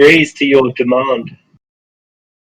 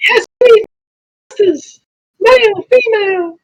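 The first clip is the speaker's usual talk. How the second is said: very high squeaky rat voice. Acted. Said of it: "I speak in a rat voice".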